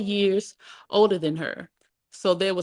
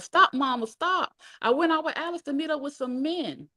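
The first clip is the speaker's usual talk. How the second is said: high, desperate cry; yelled plea; 9 semitones higher than usual. A narrator's reading. Mom confessing while being beaten, yelling. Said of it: "My mom yelled".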